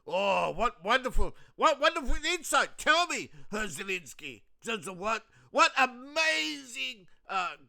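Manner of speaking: high, loud sarcastic wailing; sarcastic mock alarm. Mocking Zelensky's insight, loud high sarcasm. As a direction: ham up fake shock to mock him. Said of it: "mocking Zelensky's warning".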